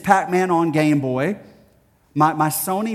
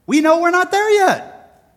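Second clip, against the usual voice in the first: high, loud preacher's punch; emphatic preaching; 8 dB louder than usual. Real, not acted. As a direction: drive the point home to the congregation. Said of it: "preaching emphasis".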